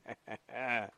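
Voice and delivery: soft, low chuckle at their expense; mocking laugh. Shown as a demonstration. Host laughing at Biden voters, soft mocking laugh. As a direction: laugh quietly in their face. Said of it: "I'm laughing at you".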